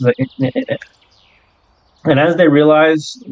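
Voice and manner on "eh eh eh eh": high, soft creature chirping; squeaky little creature noises; tiny critter yips in a high squeak; creature chirps. Acted. Nugget translating in creature sounds.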